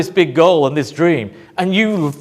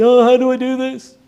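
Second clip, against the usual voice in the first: anxious voice; 6 semitones higher than usual. Acted. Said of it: "out of need, desperation, anxiety".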